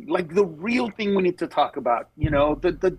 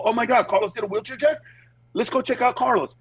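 higher-pitched eager quote; excited fans; 5 semitones higher, faster than usual. Retold or imitated. Wheelchair users hearing about the joke, excited. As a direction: quote thrilled people making plans.

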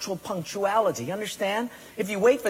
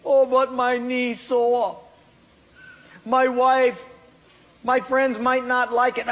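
whiny excuse; 7 semitones higher, 4 dB louder than usual. Retold or imitated. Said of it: "excuses answering God".

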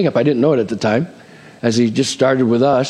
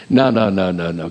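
usual speaking voice

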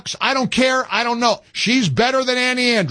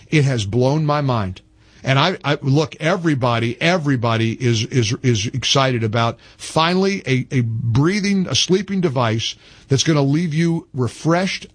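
lower-pitched, easy sponsor read; relaxed radio endorsement, pitched lower than the rant; host-read ad; 6 semitones lower than usual. Acted. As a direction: slide into a chatty live ad for the sleep device.

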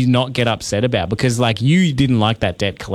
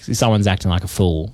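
normal talking voice

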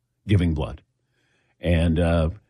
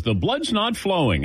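higher-pitched, lightly voiced nurse; imitating nurse; 7 semitones higher than usual. Nurse tells host his blood isn't flowing, lightly imitated. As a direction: quote the nurse a little higher.

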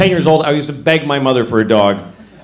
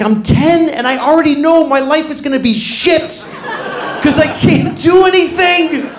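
mock despair; 10 semitones higher than usual. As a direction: wail it like a hopeless ten-year-old. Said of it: "I was like".

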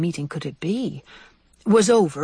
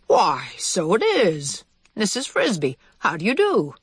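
put-on old mouse voice. Narrator voices Mister Aegis.

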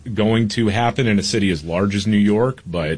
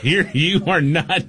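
mock parental scolding; 8 semitones higher, slower than usual. Dad acting out forbidding the word.

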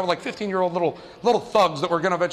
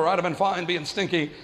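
ordinary talk

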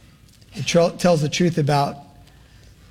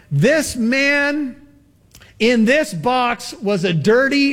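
high, slow funeral-eulogy declamation; pastor addressing the crowd; 7 semitones higher than usual. Acted. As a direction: draw out each accusation in a high pastor's voice. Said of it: "the pastor began to address the crowd".

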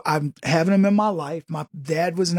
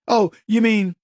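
ordinary talk, nothing marked

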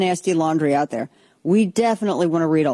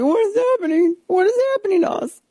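much higher, squeaky mock-panicked voice. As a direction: play the markets as a shrieking, panicked victim. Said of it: "They're like".

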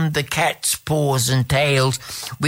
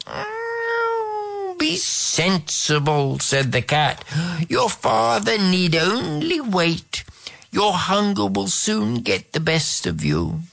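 wise, purring cat voice; 7 semitones higher than usual. Acted. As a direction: speak as the sly palace cat. Cat voice, purple timbre.

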